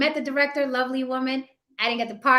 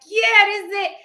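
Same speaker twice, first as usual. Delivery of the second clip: jumping up in pitch and volume, sure this is the break; thrilled; 9 semitones higher, 7 dB louder than usual. Retold or imitated. Actor recalling his audition news, excited outburst. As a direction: let it burst out like the big moment finally came. Recalls landing a big role.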